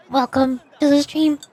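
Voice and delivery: ordinary talk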